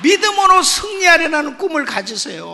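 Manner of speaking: loud, high exhortation to the congregation; preaching at full force. Acted. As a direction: call out the charge with full power. (설교 절정에서 외치는 권면)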